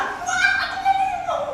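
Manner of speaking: very high, eager plea; the man begging to be let into the party. Acted. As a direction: beg like a kid wanting a treat. Man begs to switch.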